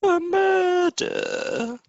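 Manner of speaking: very high, drawn-out falsetto; teasing falsetto, stretched out; mock-dramatic echo. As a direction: squeal it slowly in mock alarm. Teasing echo of her murder theory.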